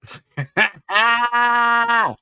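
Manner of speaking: high burst of laughter; loud laugh. Real, not acted. Laughing at a comment.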